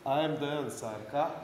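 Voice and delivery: ordinary delivery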